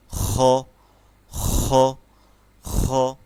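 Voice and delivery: rough throaty খ from the back; kha demo. Shown as a demonstration. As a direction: scrape খ in the throat. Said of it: "খর উচ্চারণটা এমন হবে".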